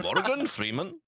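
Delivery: high, slow mock Indian accent; a hammed-up Indian accent, pitched high. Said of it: "the Indian version".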